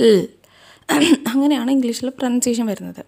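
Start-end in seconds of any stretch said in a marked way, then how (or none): 0.1-0.2 എൽ said as a clear English el; letter sound demo. Shown as a demonstration. Teacher demonstrating the letter എൽ. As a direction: say എൽ as a clean el. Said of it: "അതിൻ്റെ pronunciation വരുന്നത് എൽ".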